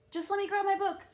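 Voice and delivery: high, loud call over the shoulder; calling back. A narrator's reading. Girl calls back to the librarian, high and loud. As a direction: shout it back while hurrying off. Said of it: "I called back".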